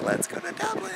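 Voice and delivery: high-pitched eager exclamation; mock excited squeal. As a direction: squeal it like a sudden whim. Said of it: "so I was like".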